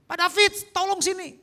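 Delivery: retold call for help. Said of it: "dia panggil nama saya". (memanggil minta tolong)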